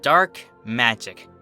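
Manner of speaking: plain reading voice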